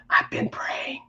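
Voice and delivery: soft, voiceless retold confession; mouthed whisper. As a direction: imitate someone silently mouthing, barely whispered. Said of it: "And Laney mouthed".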